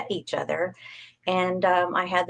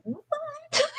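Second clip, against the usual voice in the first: a much higher, softer "What?" that tips into a laugh; stunned what; 7 dB quieter than usual. Retold or imitated. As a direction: disbelief that cracks into laughter. Acted double-take at the conference.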